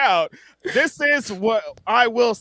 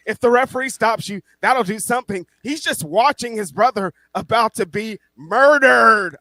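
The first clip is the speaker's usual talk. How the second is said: usual speaking voice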